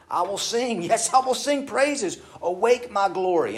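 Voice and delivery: exultant psalm reading; joyful praise. Acted. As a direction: burst out singing-happy at God.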